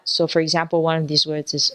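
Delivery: ordinary talk, nothing marked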